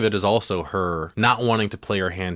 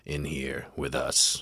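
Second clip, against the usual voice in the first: lower, slow, soft demonic murmur; mock demon voice; 6 semitones lower, 7 dB quieter, slower than usual. The possessed girl answering the priest in a low mock demon voice. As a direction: drop it down and slow like a lightly done demon. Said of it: "And she's like".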